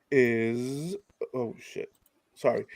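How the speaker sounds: normal talking voice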